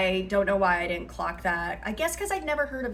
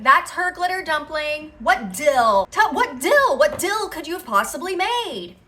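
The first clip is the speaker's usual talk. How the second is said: indignant commenters; 6 semitones higher, 6 dB louder than usual. Retold or imitated. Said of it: "everybody's like".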